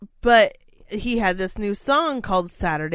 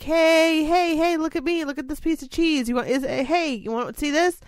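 put-on higher sing-song coaxing a baby; baby-talk parent; 7 semitones higher than usual. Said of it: "probably like".

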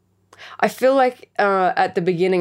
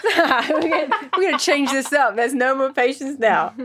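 laughing, higher; 9 semitones higher than usual. Real, not acted. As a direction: giggle through it. Laughing declaration.

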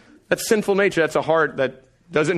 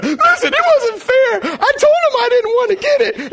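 much higher, louder, whiny sob-talk; mock crying kid; 6 dB louder than usual. Son tearfully complaining to dad, high and crying. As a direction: wail like a wronged child. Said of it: "now Judah starts crying".

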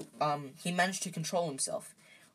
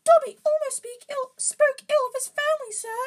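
very high, loud squeaky elf; Dobby; 10 dB louder than usual. A narrator's reading. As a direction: squeak excitedly in a high falsetto. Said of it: "said the elf".

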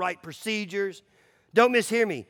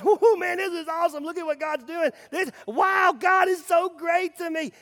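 much higher, gushing mock delight building to a shout; giddy self-absorbed joy; 10 semitones higher than usual. Pastor mocking a self-absorbed believer, high and gushing. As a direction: play a believer overjoyed only for himself. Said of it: "consumed thinking".

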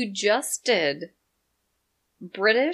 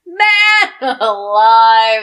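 very slow loud drawn-out cry; slow booming bellow; 2 semitones higher, 14 dB louder, much slower than usual. Acted. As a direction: stretch it out in a big shout. Repeated, louder and slower.

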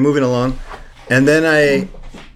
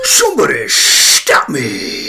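slow, louder Mask impression; 5 dB louder, slower than usual. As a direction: stretch it out like the Mask. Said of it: "Do I gotta say the same thing?".

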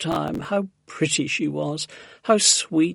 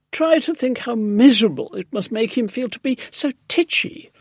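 high-pitched, emphatic plea; distressed cry; 7 semitones higher, 4 dB louder than usual. A narrator's reading. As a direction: plead on the pet's behalf, pitched up. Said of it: "Missus Silver cried".

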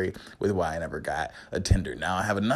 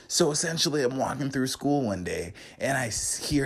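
ordinary delivery

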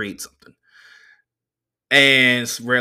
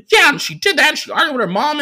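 much higher, louder nerdy driver voice; the driver's voice; 7 dB louder than usual. Retold or imitated. Comedian doing the driver's voice for the crowd, high and squeaky.

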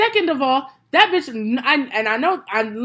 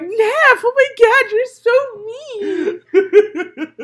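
mocking high whine; 10 semitones higher, 5 dB louder, much slower than usual. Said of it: "They be like".

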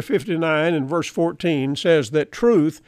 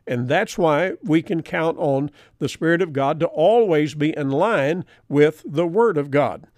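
ordinary delivery

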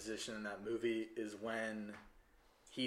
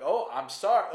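higher, louder, sheepish sorry as Henry; mock apology; 7 semitones higher, 8 dB louder than usual. Henry apologizes to Karen, higher and louder. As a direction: play Henry caught out, apologizing. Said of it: "he's like".

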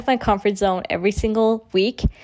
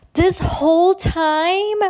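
incredulous receptionist; 8 semitones higher, much slower than usual. Retold or imitated.

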